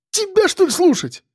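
very high indignant squeal; outraged falsetto yelp. Acted. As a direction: squeal the retort in disbelief. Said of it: "взвизгнул он".

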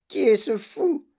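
put-on high, drawn-out old-woman screech; yelled. A narrator's reading. Grandmother demanding who the madman is, yelling. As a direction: shriek the question in a put-on voice. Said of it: "hurla grand-maman Joséphine".

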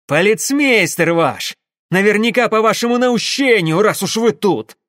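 loud, high, heated retort; angry accusation. Acted. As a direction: fling the blame back at him. Enraged engineer accuses the father. (гневное громкое обвинение)

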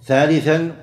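normal talking voice

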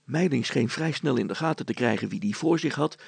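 usual speaking voice

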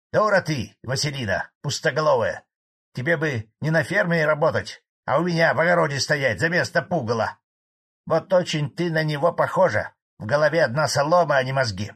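high, loud, scornful scolding; angry insults. A narrator's reading. Old farmer abuses Vasilina for heckling, angrily. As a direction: tear into her with contempt.

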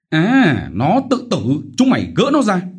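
very high, loud alarmed yell; alarmed shouted order. Acted. Chief ordering guards to stop Vít, alarmed shout. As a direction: shout for them to stop her.

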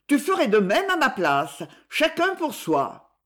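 defiant retort. A narrator's reading. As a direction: throw it back at your brother. Sister retorts in quarrel.

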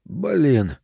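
taken aback, a drawn-out curse; mutedly dumbfounded; stunned. A narrator's reading. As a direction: let out a stunned little curse. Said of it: "сказал он поражённый".

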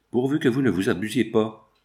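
ordinary delivery

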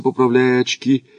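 plain reading voice

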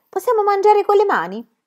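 put-on high, timid child's voice; little girl asking. A narrator's reading. Annika asking if they may eat with their hands, in a high child's voice. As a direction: ask in a high little girl's voice.

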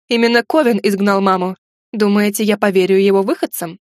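plain reading voice

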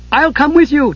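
high-pitched eager character voice; high, excited call; eager cry. A narrator's reading. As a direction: pipe up high, volunteering at once. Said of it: "Tass cried".

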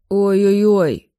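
drawn-out hammed-up "ой-ой-ой"; feigned cry of pain. A narrator's reading. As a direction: fake a painful moan, slowly. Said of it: "вскрикиваю". (наигранный стон боли, протяжно)

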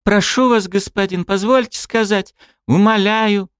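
put-on high, pleading young woman; pleading girl. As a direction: beg on your knees. Kneeling girl begging.

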